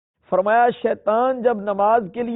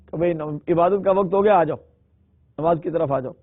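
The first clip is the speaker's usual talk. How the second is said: normal talking voice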